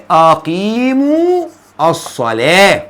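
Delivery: very slow, long vowel in أقيموا; word-by-word teaching recitation; drawn-out repeat. Shown as a demonstration. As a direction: stretch أقيموا out, then pause before الصلاة. Repeats verse slowly to show ending.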